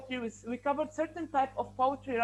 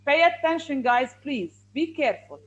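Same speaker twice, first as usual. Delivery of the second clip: raised voice, telling off the class; louder, insistent demand for attention; firm warning; 5 dB louder than usual. Real, not acted. Teacher warns students who keep unmuting.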